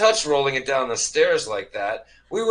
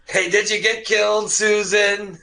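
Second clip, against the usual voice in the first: higher voice calling up the stairs; calling upstairs; 8 semitones higher than usual. Acted. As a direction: call up to her.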